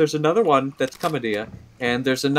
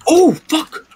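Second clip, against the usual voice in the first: startled; 13 semitones higher, 7 dB louder than usual. Real, not acted.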